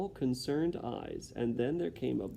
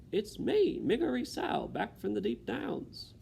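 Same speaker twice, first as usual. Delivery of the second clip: Mig shouting; 10 semitones higher than usual. Acted. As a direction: call out proud and simple. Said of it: "she shouted".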